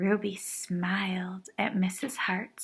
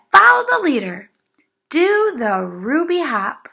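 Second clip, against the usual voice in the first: high, loud, fast call to play; rally the class to join in; cheerful call; 7 semitones higher, 10 dB louder than usual. A narrator's reading. Teacher leading class game.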